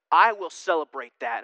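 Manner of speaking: normal talking voice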